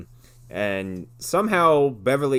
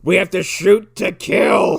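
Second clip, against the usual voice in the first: slow, loud, high hammed-up cop threat; mock dramatic shout; 7 semitones higher, 8 dB louder, slower than usual. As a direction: shout it slowly as an overblown movie cop. Retold cop's zinger threats.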